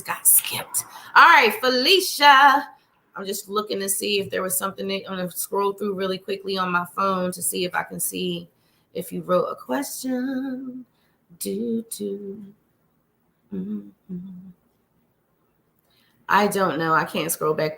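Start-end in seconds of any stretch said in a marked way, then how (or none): 3.1-14.5 soft distracted mumbling while scrolling; murmuring to herself. Real, not acted. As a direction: mutter under your breath.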